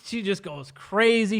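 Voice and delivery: usual speaking voice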